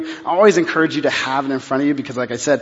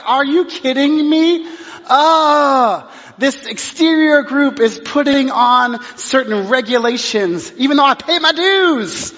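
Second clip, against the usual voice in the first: upset outburst; 7 semitones higher than usual. Retold or imitated. Pastor venting at store policy, high exasperated rant. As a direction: let the outrage fly in a strained high voice. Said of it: "I was very upset".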